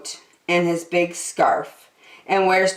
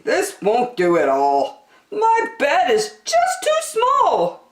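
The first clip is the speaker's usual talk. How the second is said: high, slow and fussy; worried old keeper, pitched up; Percy fretting; 11 semitones higher than usual. A narrator's reading. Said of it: "said Percy".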